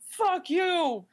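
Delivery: high, hammed-up confrontation; over-the-top accusing yell; mock angry outburst. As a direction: pick a fight like a soap-opera wrestler. Acted quarrel between players.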